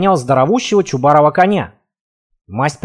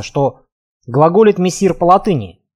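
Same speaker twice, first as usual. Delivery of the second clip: plain reading voice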